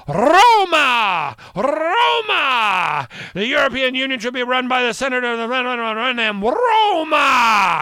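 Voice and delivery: loud, high impassioned shouting; rally the crowd in a hammed-up shout; mock rally shout. Italy's leader addressing a crowd, hammed-up loud shouting. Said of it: "she's going like".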